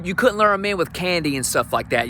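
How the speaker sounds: usual speaking voice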